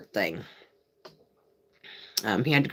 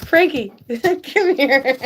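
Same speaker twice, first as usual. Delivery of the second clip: high, slow laughing call to the dog; 8 semitones higher, 5 dB louder, slower than usual. Real, not acted. Calling Frankie the dog over, laughing. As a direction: call the dog through giggles.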